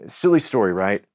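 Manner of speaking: usual speaking voice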